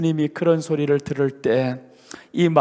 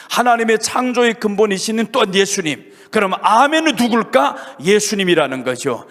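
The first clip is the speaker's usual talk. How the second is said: usual speaking voice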